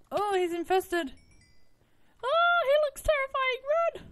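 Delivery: panicked high shrieks; yelling while running away. Real, not acted. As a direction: scream and flee in terror. Fleeing an infested zombie.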